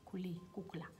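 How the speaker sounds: breathy whisper under her breath; whispered read-out. Real, not acted. Reading a viewer's typo aloud to herself, whispered. Reads a misspelled comment aloud.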